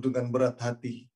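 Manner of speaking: usual speaking voice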